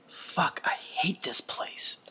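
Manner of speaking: whispered, slow and bitter; fed-up skater cursing to himself after a thud; whispered curse. A narrator's reading. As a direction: breathe the curse out under your breath. Muttered curse after loud thud.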